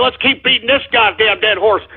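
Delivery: ordinary delivery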